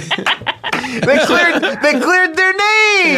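high, drawn-out mock cheer with laughter; mock excitement. Joking about the famous cops' release, mock excitement. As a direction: play it up as thrilling news.

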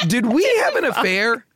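ordinary talk

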